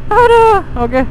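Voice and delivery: laughing high, then aduh; high cracking laugh. Real, not acted. Laughing at lost friends.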